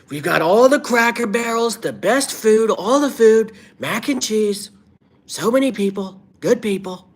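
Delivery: high, loud caricature of a boastful politician; hammed-up salesman-politician, pitched very high; Donald Trump. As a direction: push the voice way up into a strained impression. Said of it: "let's do an impression".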